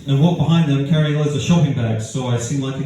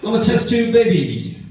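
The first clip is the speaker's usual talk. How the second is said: put-on "test tube baby" said high, cool and casual, leaning on "test"; 6 semitones higher than usual. Shown as a demonstration. Comedian reciting a joke line in a cool way, high and casual. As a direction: drop "I'm a test tube baby" like it's the coolest thing. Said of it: "in a cool way".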